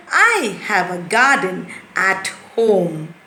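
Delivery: slow, clear model reading; slow correct sentence. Shown as a demonstration. Teacher contrasts the correct sentence with the jumble. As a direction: say the fixed sentence slowly so it makes sense. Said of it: "But if they say".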